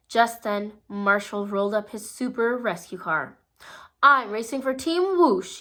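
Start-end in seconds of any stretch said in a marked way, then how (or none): 4.0-5.6 proud declaration. A narrator's reading. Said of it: "he declared".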